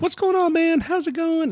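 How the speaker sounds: high, chummy mimicked hello; super friendly. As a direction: imitate a sociable, chatty greeter in a high-pitched voice. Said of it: "super friendly and sociable".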